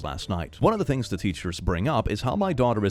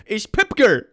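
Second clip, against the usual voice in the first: put-on insistent little girl; 9 semitones higher, 5 dB louder than usual. Four-year-old insisting on her name.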